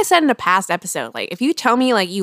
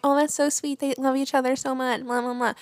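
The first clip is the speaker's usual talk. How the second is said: soft, higher, sarcastic coo; a fake-sweet sarcastic coo; mock sweetness; 5 semitones higher, 7 dB quieter than usual. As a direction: coo it sweetly but mean it mockingly. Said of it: "And I'm like".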